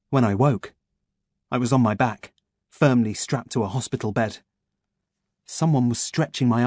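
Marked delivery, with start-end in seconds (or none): none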